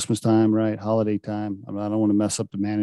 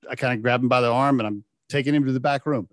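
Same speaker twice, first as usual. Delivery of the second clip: ordinary talk, nothing marked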